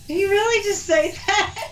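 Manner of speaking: mock disbelief. Dog owner's reaction retold to friends, mock disbelief. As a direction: quote it with amused disbelief.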